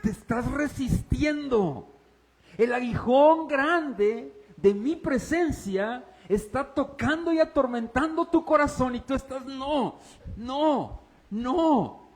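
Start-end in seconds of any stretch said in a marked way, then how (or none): 0.0-8.9 high, slow, booming voice of the Lord; voice of God. Acted. Voz de Dios en la predicación. 9.4-12.1 high, pleading no; stubborn refusal. Acted. Pablo resistiendo a Dios.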